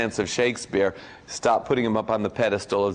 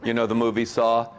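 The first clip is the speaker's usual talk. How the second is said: ordinary delivery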